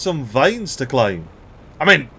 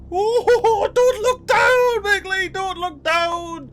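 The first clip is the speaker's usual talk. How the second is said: high-pitched, drawn-out fright; slow falsetto wail of playful vertigo; mock panic; slower than usual. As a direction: squeal it like you're scared of heights. Climbing high, telling himself not to look.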